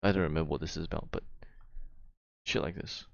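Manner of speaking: ordinary delivery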